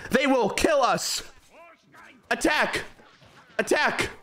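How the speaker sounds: high, hammed-up war chief yelling orders; rally the tribe into battle, bellowing. A war chief rallying his tribe against attackers, shouting.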